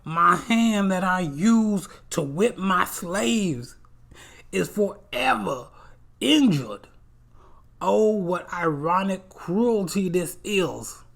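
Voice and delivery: high, loud, slow hammy agony; pained villain. Retold or imitated. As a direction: ham up a wounded slaver's lament. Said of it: "he's like".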